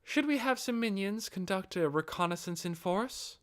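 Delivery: a composed, measured Albedo-style suggestion; restrained tone. Acted. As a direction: propose it carefully, holding back. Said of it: "in a rather restrained tone".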